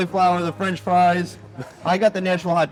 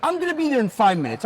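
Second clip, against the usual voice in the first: same line again, pitched higher with exasperation; exasperated repeat; 6 semitones higher than usual. Retold or imitated. Telling his sister again, higher and exasperated. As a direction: repeat it like you can't believe you have to. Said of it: "I say".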